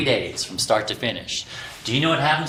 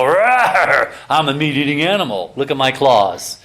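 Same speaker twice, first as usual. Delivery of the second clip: mock meat-eater growl; 2 semitones higher, 5 dB louder than usual. Mocking a man who pretends to have claws, hammed-up growl. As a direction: play a fake beast for laughs. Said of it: "and he'll go".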